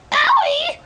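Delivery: very high, loud wail of pain; demonstrated pain yell. Shown as a demonstration. Showing how one reacts when something hurts, pained yell. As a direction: yelp it out, pitch leaping way up. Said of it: "You go".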